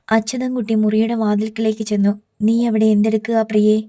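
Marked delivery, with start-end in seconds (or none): none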